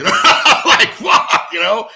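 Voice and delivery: high, loud burst of laughter; real laughter; laughing. Real, not acted. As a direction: laugh through the words in disbelief. Laughs recalling his shock.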